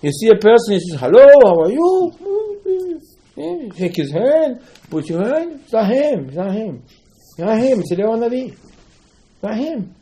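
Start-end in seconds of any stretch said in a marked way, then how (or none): 1.0-2.1 much higher and louder, a booming friendly hello; hearty greeting. Retold or imitated. Voicing a stranger's greeting. 3.4-9.9 much higher, slow, driving home it's not him; excited insistence. Real, not acted. Excited Talmud explanation.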